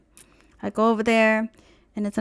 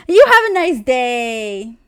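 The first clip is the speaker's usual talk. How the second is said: slow, sugary sarcastic farewell, a touch louder; fake polite sarcasm; 2 semitones higher, 7 dB louder, slower than usual. Leaving the dealership, telling the salesman off with fake politeness. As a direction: sweetly dismiss the salesman on the way out. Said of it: "and I was like".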